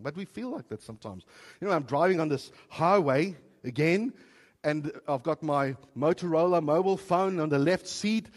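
ordinary talk, nothing marked

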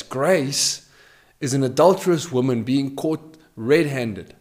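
normal talking voice

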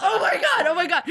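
high, giggly oh my God; laughing disbelief. Retold or imitated. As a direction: squeal through laughter. Laughing disbelief retelling the moment.

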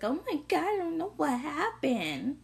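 high, clueless whiny mock; mocking drunk girl. Mocking a girl waking up after a drunk night, high and clueless. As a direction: play dumb in a ditzy voice. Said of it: "it's like".

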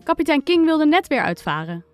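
plain reading voice

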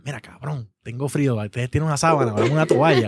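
low-pitched, matter-of-fact model request; demonstrated plain request. Shown as a demonstration. Bro shows friend how to wake them and ask, pitched low.

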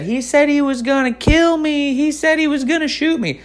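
put-on very high, whiny kid voices tattling; mock kids accusing. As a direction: parody the classmates ganging up on him. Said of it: "stood up and said".

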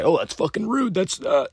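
high-pitched imitation of someone offended; mock offended. The next guy objecting to blunt advice, mock offended.

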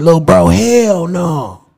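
drawn-out, emphatic brotherly dismissal; emphatic retold denial. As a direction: stretch it out slowly, imitating big brother.

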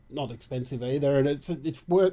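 ordinary delivery

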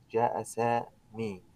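جاء سامي slowly, no ending heard; slow, soft جاء سامي; slow demo. Shown as a demonstration. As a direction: spell it out word by word. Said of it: "أين هي الضمة".

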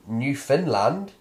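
"Newfoundland" as New Finland; slow "Newfoundland" with "Finland" at the end; like a Scandinavian colony. Shown as a demonstration. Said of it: "as if it were somehow a Scandinavian colony".

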